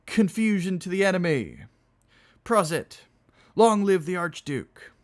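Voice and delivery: high, loud drinking cheers; barked toasts. Acted. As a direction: bark out each toast like a chorus. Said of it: "barked the chorus".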